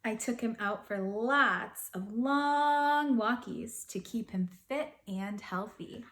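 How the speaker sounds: ordinary delivery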